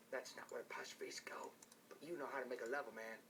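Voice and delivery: hushed whispered aside. Real, not acted. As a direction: whisper it under your breath. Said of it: "unvoiced whisper".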